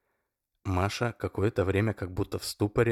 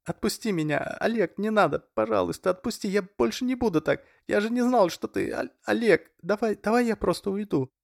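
begging; 13 semitones higher, 3 dB louder than usual. A narrator's reading. Masha begs Oleg to let go of her hair, much higher. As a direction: plead for mercy. Masha pleading while held.

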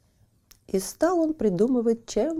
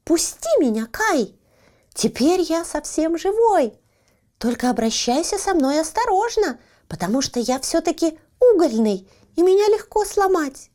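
high, thin squeaky little voice; 8 semitones higher, 4 dB louder than usual. A narrator's reading. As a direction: squeak it out like a tiny coal man. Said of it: "он пропищал".

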